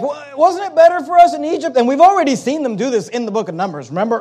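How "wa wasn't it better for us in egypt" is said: higher, mildly whiny complaining voice; lightly mock the complainers longing for Egypt; whining Israelites. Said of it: "And they're like".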